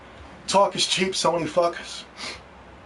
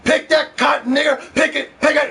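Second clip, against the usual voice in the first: higher, louder barked orders; retold master's shout; 9 semitones higher, 4 dB louder than usual. Slave master in the commercial yelling at slaves, shouted. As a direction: yell it down at the workers like a cruel boss. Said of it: "the slave master rolled up on these slaves and said".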